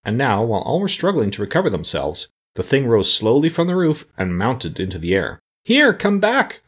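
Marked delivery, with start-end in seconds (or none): 5.6-6.6 high-pitched, alarmed call; frightened cry. A narrator's reading. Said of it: "cried Tip in a frightened voice".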